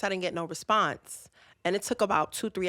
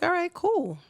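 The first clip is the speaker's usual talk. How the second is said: chirpy okay; 12 semitones higher than usual. Retold or imitated. Mock cheerful agreement to boyfriend.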